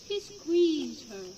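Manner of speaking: ordinary delivery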